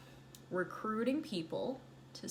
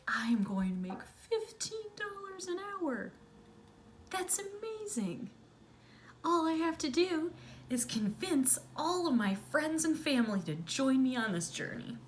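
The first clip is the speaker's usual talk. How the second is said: high, excited, rushing dream voice; mock-giddy teen dreaming of money; giddy naive self; 9 semitones higher than usual. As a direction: gush like a starry-eyed teen.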